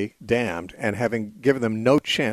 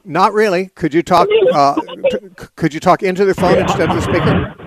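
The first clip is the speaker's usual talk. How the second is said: loud; 7 semitones higher, 8 dB louder than usual. Real, not acted. Straining to hear caller.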